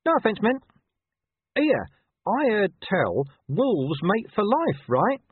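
high, loud, over-friendly dog; chatty pushy dog. A narrator's reading. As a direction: keep trying to make conversation. Dog keeps pestering she-wolf.

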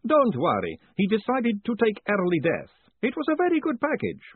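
brisk, high, chatty academic; high professor voice. Acted. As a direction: lift the pitch for the cheerful professor. Pelk reassuring Moist.

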